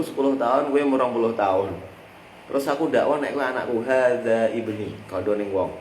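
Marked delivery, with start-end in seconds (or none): none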